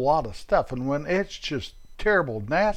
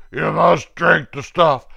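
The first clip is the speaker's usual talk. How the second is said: bossy nurse; 3 semitones higher, 6 dB louder than usual. Retold or imitated. Acted quarrel, nurse versus patient.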